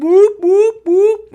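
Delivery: high loud whooping siren call; submarine noise. Pretend. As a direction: whoop like a sub alarm. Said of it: "do you wanna make a mouth noise for the, like, a submarine noise?".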